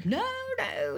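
drawn-out pretend horror; retold scream. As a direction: imitate a pleading scream at held-back volume. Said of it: "and he screams and pledges".

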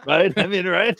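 laughing through the words. Real, not acted. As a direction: giggle it out, high. Laughing at Astroglide joke.

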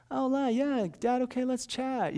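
high, sing-out mock casual voice; mock breezy chat. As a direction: parody a carefree little chat with Dad. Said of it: "it's not like".

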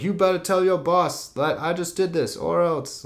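high-pitched retold warning; mock threat. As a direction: put on a hammed-up threat.

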